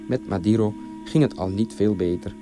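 usual speaking voice

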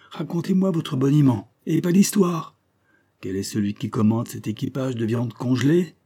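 stern skipper demanding answers; interrogating captain. Acted. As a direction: question the crew with no nonsense. Captain questions the castaways.

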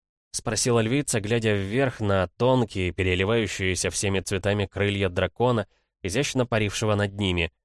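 ordinary delivery

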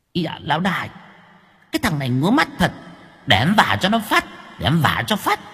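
high, fast impish demon; a cocky little demon itching to fight; demonic double voice. A narrator's reading. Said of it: "giọng của quỷ oa vang lên".

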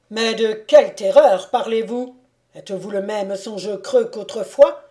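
loud, scornful questioning; blustering, irritated comeback; indignant retort. A narrator's reading. Scornful denial of terror to brother.

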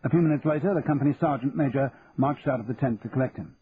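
ordinary delivery

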